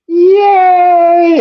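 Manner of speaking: high, loud, drawn-out yay; playful cheer. Pretend. Host cheering along with the bit, exaggerated cheer. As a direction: let out one long high cheer. Mock celebration of the joke.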